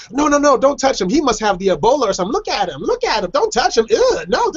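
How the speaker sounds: high, alarmed mock disgust; mock stranger's panicked high-pitched disgust; disgusted recoil. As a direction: shoo everyone away from the contagious guy, squealing. Stranger recoils from blind man.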